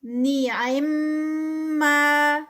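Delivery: نعما with ghunna on the میم and a two-count ما; tajweed recitation. Shown as a demonstration. Teacher models نعما for students. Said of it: "میم کا غنہ".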